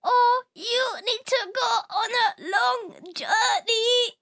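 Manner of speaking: put-on high, shaky old robot. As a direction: creak it out like an ancient robot.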